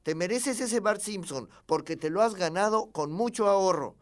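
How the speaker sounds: an insistent dad cheering on his son; urging father. Acted. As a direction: egg the child on loudly. Father urges son to smash bank.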